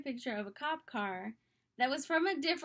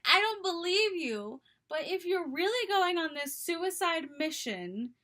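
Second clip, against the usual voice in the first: raised, indignant imitation of a livid friend; hammed-up outrage, high and louder; mock scream; 5 semitones higher, 4 dB louder than usual. Best friend challenging his suicide mission story, mock scream. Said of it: "so that she could scream directly at".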